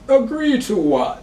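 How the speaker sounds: high, loud, drawn-out challenge; slow, high-pitched interruption from an unseen figure; voice cutting in. Acted. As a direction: butt in loudly, stretching the words. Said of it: "you hear a voice saying".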